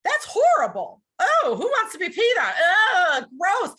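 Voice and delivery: high, loud mock disgust. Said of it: "A lot of people might say".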